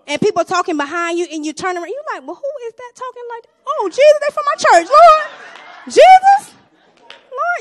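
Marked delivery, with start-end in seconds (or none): 3.7-7.6 high, loud mock shriek of alarm; shocked exclamation. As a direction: yelp as if caught out by church folk. Shocked at overheard church gossip.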